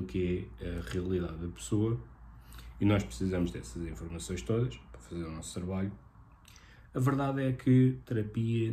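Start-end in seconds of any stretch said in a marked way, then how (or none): none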